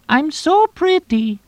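very high, slow mocking falsetto. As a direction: squeal it in a slow put-on falsetto. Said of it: "Tonino said in falsetto".

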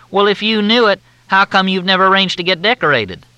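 high, loud, syrupy Southern drawl; Southern officer on the phone, in a thick drawl. Acted. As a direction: drawl it out like a courteous Southern major. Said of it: "The drawl was thicker than Mississippi mud".

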